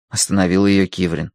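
ordinary delivery, nothing marked

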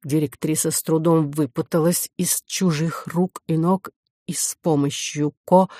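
usual speaking voice

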